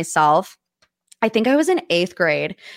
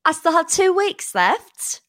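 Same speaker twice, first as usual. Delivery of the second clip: playful put-on British accent, pitched high; mock-outraged British accent, pitched up; 8 semitones higher than usual. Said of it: "that is my British accent for the day".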